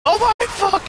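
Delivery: high, loud panicked shriek; shrieking shout. Real, not acted.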